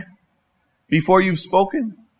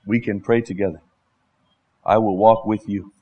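gentle loving rebuke; 5 semitones lower than usual. Shown as a demonstration. Modelling gentle correction to brother.

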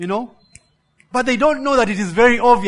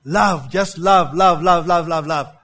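love, love, love, louder and slow, each one pressed; repeated love; 4 dB louder than usual. Acted. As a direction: hammer the word love home, slowly.

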